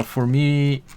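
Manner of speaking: ordinary delivery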